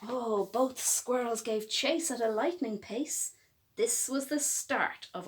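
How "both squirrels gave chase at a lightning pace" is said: ordinary delivery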